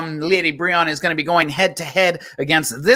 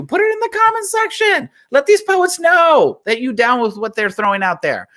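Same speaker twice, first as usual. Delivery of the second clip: hype; 9 semitones higher, 3 dB louder than usual. Real, not acted. Host hypes the online audience.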